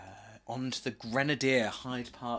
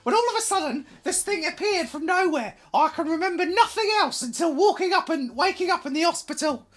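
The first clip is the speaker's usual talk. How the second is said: much higher, louder hammed-up wench voice; wench's voice; 8 dB louder than usual. Landlord's quote done as a shrieking wench. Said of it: "a wench's voice".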